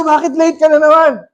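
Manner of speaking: high exasperated retold question; mock scolding. Pastor scolds late student again. (kunwaring nanenermon sa laging late)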